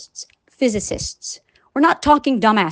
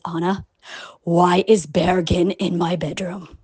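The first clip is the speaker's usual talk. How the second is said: put-on lower, slow, wary girlfriend voice; quoting upset girlfriend; 7 semitones lower, slower than usual. Girlfriend calls asking why he's in her bedroom, lower and slow. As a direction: let the question hang low and slow. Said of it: "she says".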